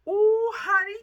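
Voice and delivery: high, loud swooning ooh; high, loud cooing delight. Real, not acted. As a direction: swoon over it. Swooning over pattern.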